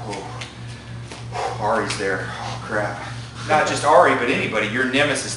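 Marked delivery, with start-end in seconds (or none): 0.1-3.0 low, muted mutter of dismay; sinking, under-the-breath dread, lightly acted; quiet dread. Student spots his nemesis before class, quiet mock dread.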